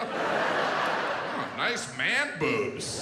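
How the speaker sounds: drawn-out mocking compliment through a giggle; giggle, then stretch out the tease; giggling doctor. Doctor laughing at a patient during an exam, giggly. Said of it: "a doctor giggling during an exam".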